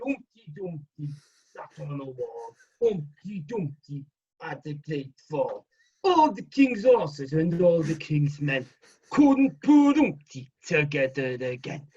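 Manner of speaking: put-on slow Liverpool-accented recital; drawn-out Scouse nursery rhyme; slow, unhurried Liverpool comic voice; John Bishop. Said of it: "John Bishop doing Humpty Dumpty".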